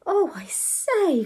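slow, high, drawn-out exclamation of surprise; surprised cry. A narrator's reading. Ethel seeing her handsome room, crying in surprise. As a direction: exclaim high, stretching it in astonishment. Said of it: "cried Ethel in surprise".

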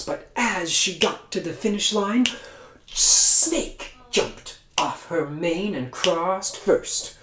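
ordinary delivery, nothing marked

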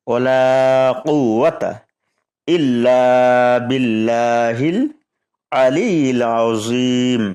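measured recitation with long vowels; kalima recitation. Shown as a demonstration. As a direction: recite the tamjid slowly for learners. (Tamjid তিলাওয়াতের ভঙ্গিতে পড়া)

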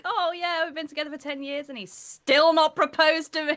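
mock complaining. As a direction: moan about it playfully. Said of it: "other people like me that are like".

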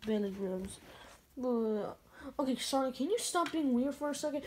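ordinary talk, nothing marked

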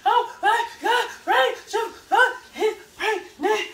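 high, slow sobs, word by word; mock hysterical crying. Daughter imitates mom phoning grandma, hysterically crying. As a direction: heave out each syllable in fake sobs. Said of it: "She was hysterically crying".

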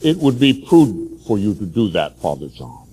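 lightly acted pious tempter, kept low; devil as angel of light. Devil posing as God to Father John, low counsel. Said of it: "He comes as an angel of light".

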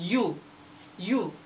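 rounded lip "यू" twice; sounding u. Shown as a demonstration. Teacher demonstrating the you sound to kids. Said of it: "यूरोपियन बोल करके देखिए".

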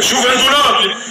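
high, hyped shouts of praise; excited cheering. Real, not acted. Host cheering a lagging player in the lobby, excited high calls.

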